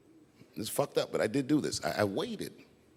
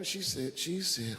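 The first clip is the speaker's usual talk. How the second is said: ordinary talk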